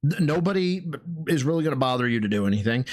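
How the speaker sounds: ordinary delivery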